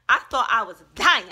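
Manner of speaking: half-laughing loud dramatic claim; big storyteller exaggeration; loud dramatic exclaim. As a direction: blurt it out loud like it nearly killed you. Dramatic retelling of the shot burn.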